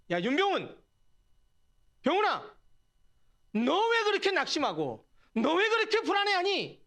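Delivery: high-pitched, insistent challenge; acted scolding of oneself, pitch climbing; direct self-rebuke. Shown as a demonstration. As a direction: call yourself out by name, pressing each question. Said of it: "더 직접적으로 얘기해 볼까요?".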